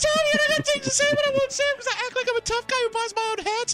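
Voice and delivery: high whiny mocking voice, laughing; shrill sneering mockery of a coworker; bitch voice. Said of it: "We call Bitch Voice Theater".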